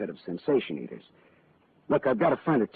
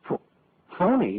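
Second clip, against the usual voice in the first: stunned disbelief; 10 semitones higher than usual. Acted. Shocked the pearls are fake.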